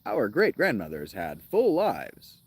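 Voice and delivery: slow, swooping narrator parody; mocking audiobook reader. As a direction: make fun of the reader's overdone emphasis. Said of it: "not to make fun of him".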